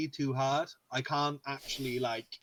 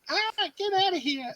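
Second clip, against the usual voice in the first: much higher, loud brash New York voice; mock New Yorker; 5 dB louder than usual. Friend mocks dubbed thug, mock New York accent. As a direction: bark it like a New York cabbie. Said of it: "I'm walking here".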